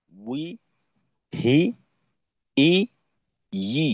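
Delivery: short clipped syllables one by one; teacher modelling pure syllables; syllable demo. Shown as a demonstration. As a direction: drill the i sounds crisply, one per beat. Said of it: "বানান ছাড়া উচ্চারণগুলো এমন হবে".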